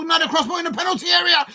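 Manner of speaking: high, quick match call; excited commentary. Real, not acted. Fan calls another cross into the box, excited.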